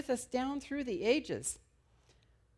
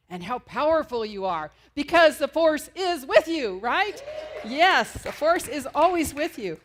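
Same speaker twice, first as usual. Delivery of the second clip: rousing; 6 semitones higher, 11 dB louder than usual. Real, not acted. Rousing affirmation to congregation.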